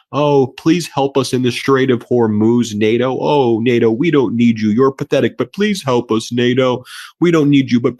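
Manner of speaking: mocking the president begging NATO for help. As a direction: beg NATO in a pathetic sarcastic whine. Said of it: "all you do now is beg".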